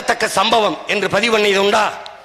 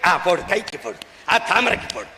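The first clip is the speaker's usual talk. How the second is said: mock vote canvasser; 4 dB quieter than usual. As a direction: coax the vote like a party tout. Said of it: "நீ மானம் கெட்டு போய்". (தணிந்த குரலில் ஓட்டு கேட்கும் கேலி)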